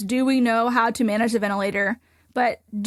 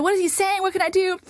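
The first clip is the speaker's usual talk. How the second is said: worried question; 7 semitones higher than usual. Retold or imitated. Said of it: "And I said".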